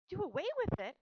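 high, loud, shocked outcry; shriek. A narrator's reading. Girl challenging her mother about the runt, shrieking. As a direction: shriek it in alarmed disbelief. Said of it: "shrieked Fern".

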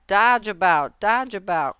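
crying out orders. A narrator's reading. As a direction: cry out orders like a bossy goose. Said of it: "cried the goose".

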